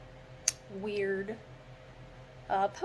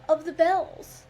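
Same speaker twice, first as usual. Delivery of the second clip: loud, higher shout of the bells; loud burst; 7 semitones higher, 9 dB louder than usual. Acted. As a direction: belt the refrain out. Sudden loud burst in refrain.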